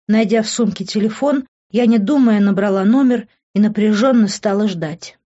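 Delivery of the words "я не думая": ordinary delivery, nothing marked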